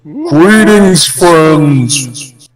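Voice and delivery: electronically altered voice; voice-changer filter, spooky. Real, not acted. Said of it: "My voice changer is working".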